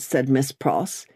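usual speaking voice

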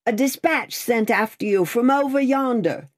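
high, carried message; calling out. A narrator's reading. Rider announces a dispatch from the mist, calling out. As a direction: call the news across to the coach.

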